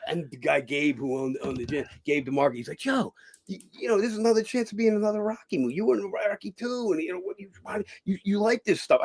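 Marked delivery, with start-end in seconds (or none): 2.8-9.0 imitated gym owner. Gym owner Gabe voiced.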